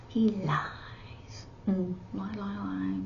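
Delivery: hushed, sly tattling; whispered accusation. Pretend. Sign teacher whispering that someone lies, quiet tattling. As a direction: whisper it as if telling tales.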